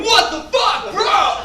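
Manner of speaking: high, far-off mock bro yelp; bros' outrage. Neighbor bros pouring out of the house, mock bro yelp. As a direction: play a dumb, startled frat bro. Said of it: "pour out going".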